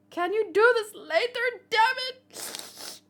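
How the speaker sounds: high, loud, mock-outraged yell; exasperated shout. Comrade interrupting the tearful reunion, shouting in exasperation. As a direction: yell at the couple to save it.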